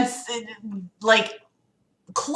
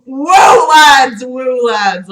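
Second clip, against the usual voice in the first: mock cheering; 3 semitones higher, 7 dB louder, much slower than usual. Reader cheering the absurd line, mock whoop. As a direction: whoop it up for the lads.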